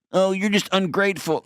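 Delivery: mock tantrum. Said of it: "those little temper tantrum-y things".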